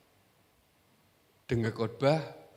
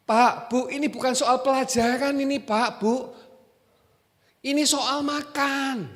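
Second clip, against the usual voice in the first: higher, louder, slowing on the last line; emphatic insistence; 11 semitones higher, 6 dB louder than usual. Acted. Preacher insists to the congregation that it is about eating, emphatic. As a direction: drive the point home.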